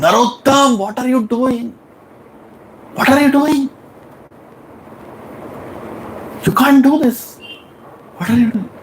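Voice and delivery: high shocked shouting at the disciple. Retold or imitated. Guru finding his disciple cleaning, high shocked shouting. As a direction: cry out in alarm, scolding. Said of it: "You start shouting".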